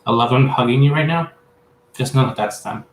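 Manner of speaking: ordinary talk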